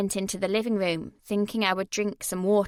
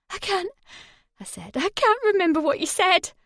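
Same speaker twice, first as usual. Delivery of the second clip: much higher, louder, desperate cry; panicked plea; 10 semitones higher, 5 dB louder than usual. Acted. Woman pinned by Jay, desperate and crying. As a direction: plead through the panic. Terrified, can't remember.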